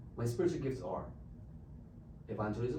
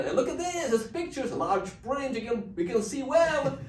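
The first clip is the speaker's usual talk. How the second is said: coaxing call; 12 semitones higher, 8 dB louder than usual. Retold or imitated. Showing book through glass.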